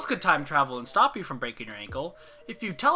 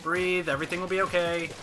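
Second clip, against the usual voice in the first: usual speaking voice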